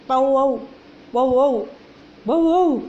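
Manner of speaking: loud double barks; loud bow-wow dog imitation. Shown as a demonstration. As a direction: bark like a dog.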